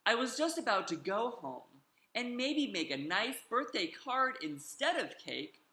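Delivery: usual speaking voice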